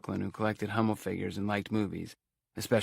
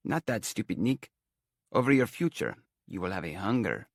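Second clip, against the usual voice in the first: put-on fortune-teller's Romany accent; prophesy in a stagey Romany accent; lightly hammed Romany seer. A narrator's reading. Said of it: "talked in a thick Romany accent".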